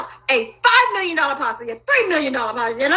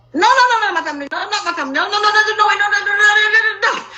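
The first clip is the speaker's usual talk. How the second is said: frantic denial; 6 semitones higher, faster than usual. Retold or imitated. Retelling how she refused to accept it, frantic no's. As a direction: hammer the no's, refusing to hear it. Reliving her own denial.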